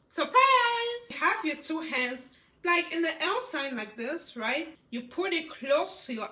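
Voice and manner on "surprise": loud, high drawn-out exclamation; surprise. Shown as a demonstration. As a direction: burst out as at a surprise party. Demonstrating surprise with emotion.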